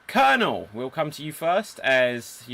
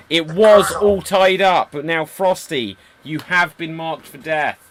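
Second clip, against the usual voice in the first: dramatic announcement; 3 semitones higher, 10 dB louder than usual. Acted. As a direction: announce her death sentence with gravity. Host announces the death sentence.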